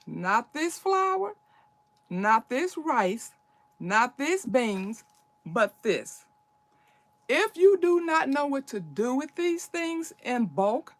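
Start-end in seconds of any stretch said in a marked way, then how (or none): 0.1-6.2 slow, emphatic, each "this" pressed hard; a slow listing with "this" stressed; contrastive stress. Shown as a demonstration. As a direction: hit every "this" deliberately. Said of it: "not this ... but this".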